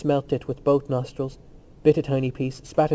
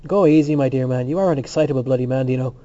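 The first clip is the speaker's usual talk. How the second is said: ordinary delivery, nothing marked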